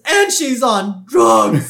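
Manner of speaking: high, loud, slowly stretched mock outcry; hammed-up scandalized shout; Helen Lovejoy. Gossip jumping in to accuse the girl, loud mock horror. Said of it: "Helen Lovejoy jumps in".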